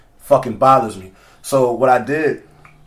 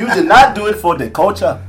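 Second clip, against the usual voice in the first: high, loud put-on African accent; laughing accusation in mock African accent; 7 semitones higher, 5 dB louder than usual. Host scolding co-host in jest, laughing mock African accent. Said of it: "say that with my African accent".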